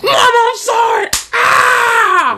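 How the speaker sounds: very high screeching wail; hammed-up high-pitched cry; mock screamed apology. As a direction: scream it like a kid in mock trouble. Mock wailing apology in banter.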